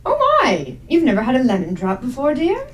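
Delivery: put-on dote on a child in astonishment; lilting "oh my" manner; surprised Agnes.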